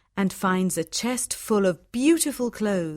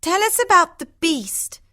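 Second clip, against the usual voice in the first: much higher complaining sister's voice; a sister grumbling about her husband, pitched up; Hortensia; 9 semitones higher, 5 dB louder than usual. Acted.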